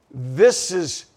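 normal talking voice

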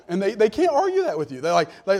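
ordinary talk

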